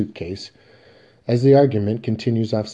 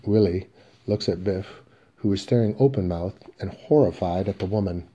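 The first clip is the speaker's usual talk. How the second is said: normal talking voice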